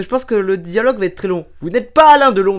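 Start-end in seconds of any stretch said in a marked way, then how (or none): 1.6-2.6 raised, higher voice, insisting; mock cop losing it in an argument; exasperated police officer. Police officer contradicting the man claiming to be Delon, exasperated. Acted police quarrel with noise-maker.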